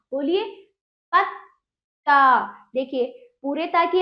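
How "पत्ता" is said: पत्ता split slowly, पत्… then ता; half-letter demonstration. Shown as a demonstration. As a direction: hold the stop in पत्ता before the full ता. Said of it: "उससे पहले आधे ता की आ रही है".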